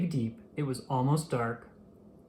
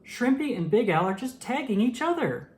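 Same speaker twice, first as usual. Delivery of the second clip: high squeaky outraged cry of unfair play; upset fish protesting; 8 semitones higher than usual. A narrator's reading. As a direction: protest like a cheated little fish, voice way up. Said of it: "All the other fish said".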